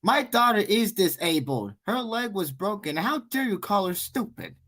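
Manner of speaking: high-pitched mock outrage; lightly acted outraged mom; indignant mother. Acted angry mother confronting someone.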